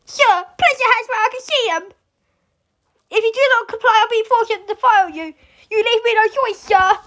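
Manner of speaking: lightly voiced cop giving orders; строгий полицейский. Acts out a cop's threat.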